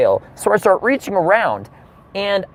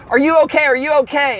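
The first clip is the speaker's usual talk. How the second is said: higher, urgent mock stewardess; worried stewardess; 7 semitones higher than usual. Stewardess runs over to check on passenger, acted alarm. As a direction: imitate an alarmed attendant rushing over. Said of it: "She runs over to me and she says".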